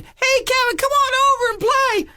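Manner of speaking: put-on high, slow sing-song kids luring him over; taunting kids. Neighborhood kids calling him over: high, slow sing-song taunt. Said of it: "they're saying".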